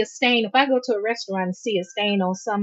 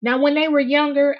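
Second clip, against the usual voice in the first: ordinary talk, nothing marked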